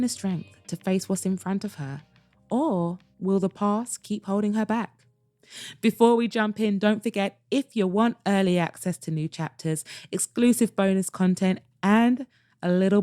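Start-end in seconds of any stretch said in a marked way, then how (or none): none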